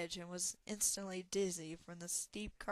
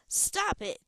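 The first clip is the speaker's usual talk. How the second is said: sharp high furious yell; 10 semitones higher, 10 dB louder than usual. Acted. Talon yells at the weeping dragon, furious. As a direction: scream at it to stop. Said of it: "Talon yelled".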